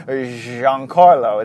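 Giancarlo drawn out slowly, each syllable relished; savoring Giancarlo. Shown as a demonstration. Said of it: "I like saying that".

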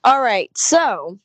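ordinary talk

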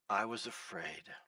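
low, soft reading of the verse; quiet quote. Shown as a demonstration. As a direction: let the words sink down softly. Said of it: "Underline the part of the verse that says".

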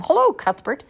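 high, loud little-creature squeak; squeaky baby crocodiles. A narrator's reading. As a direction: squeak a greeting like tiny crocodiles. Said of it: "squeak ten little crocodile voices".

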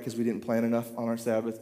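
ordinary delivery

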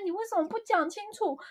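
high, fast exasperated wondering; frustrated inner question. Retold or imitated. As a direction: ask it like you can't believe they won't explain. Narrator recalls her frustrated thought.

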